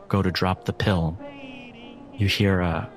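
ordinary delivery

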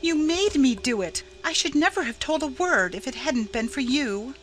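tearful protest. A narrator's reading. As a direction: protest your innocence in a high, upset voice. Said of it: "In vain Laura wept and protested".

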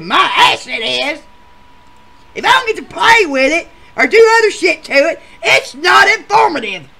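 loud laughing-crying rant; screaming protest. Retold or imitated. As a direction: yell it at her, fed up. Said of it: "quit screaming".

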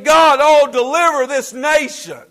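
loud, high cry of "God, oh"; pleading prayer cry. Acted. Preacher acting a burdened prayer to God, loud pleading cry. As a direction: cry out to heaven for the nation. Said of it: "crying out to God and saying".

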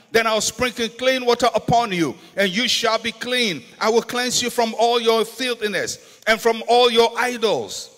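ordinary talk, nothing marked

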